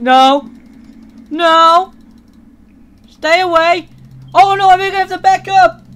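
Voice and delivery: loud, frantic screaming; panicked yelling. Real, not acted. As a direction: yell in real panic. Chased by Undyne.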